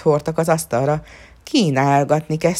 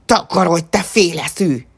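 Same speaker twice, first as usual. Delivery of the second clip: louder furious shout at the peasant; angry yell; 6 dB louder than usual. A narrator's reading. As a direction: bellow him out of the room. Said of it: "rárivolt a parasztra".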